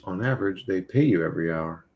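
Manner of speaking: ordinary talk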